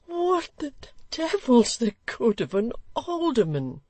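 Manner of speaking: high, slowed scornful question; scornful outburst. Acted. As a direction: scoff at the idea. Choleric gentleman.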